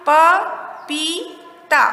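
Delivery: प, पी, ता with a pause after each; syllable by syllable. Shown as a demonstration. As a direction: sound out प … पी … ता for learners. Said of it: "क्या शब्द बना?". (प, पी, ता अलग-अलग, रुक-रुक कर)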